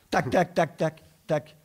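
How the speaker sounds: sharp high tac tac; tapping noise. Retold or imitated. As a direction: tap it out like a beak. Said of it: "ça faisait". (bruit de tapotement)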